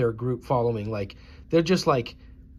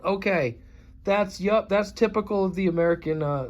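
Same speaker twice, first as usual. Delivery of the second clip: ordinary talk, nothing marked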